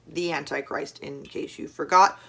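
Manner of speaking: ordinary talk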